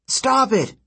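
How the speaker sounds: high-pitched urgent yell. A narrator's reading. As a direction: yell to break up an attack. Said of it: "Percy yelled".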